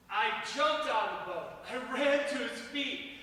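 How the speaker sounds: ordinary talk, nothing marked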